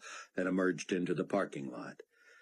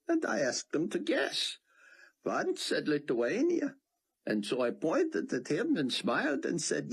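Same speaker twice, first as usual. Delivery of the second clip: put-on higher old man's voice, amused and conspiratorial; Theon, gleeful rabbi. A narrator's reading. Theon recounts fooling officers to Buck, gleeful. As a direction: tell the tale like a delighted old prankster.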